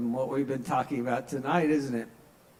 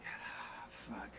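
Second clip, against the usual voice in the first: unvoiced, much softer whisper; whispered wince; 9 semitones lower, 20 dB quieter than usual. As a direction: breathe it out under your breath. Wincing after bad joke.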